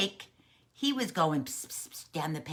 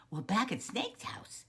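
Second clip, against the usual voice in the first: usual speaking voice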